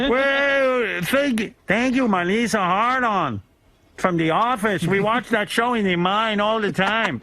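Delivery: slow, high bad Chilean accent; a hammed-up Chilean accent voice. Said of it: "I don't know how to do a Chilean accent".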